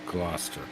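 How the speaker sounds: "Gloucester" said GLOSS-ter, softly; repeated pronunciation. Shown as a demonstration. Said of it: "Is that how you say it?".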